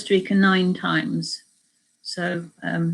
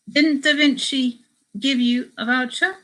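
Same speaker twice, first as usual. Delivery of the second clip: put-on higher questioning tone in a rough Spanish accent; amateur Spanish accent; 6 semitones higher, 3 dB louder than usual. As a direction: ask with an attempted Spanish accent, rising at the end. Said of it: "doing a Spanish accent".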